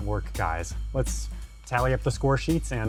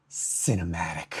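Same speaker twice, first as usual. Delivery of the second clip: hammed-up "Cinematic", pitched low; dramatic announcer; 5 semitones lower, 3 dB quieter than usual. Second, more dramatic try at word.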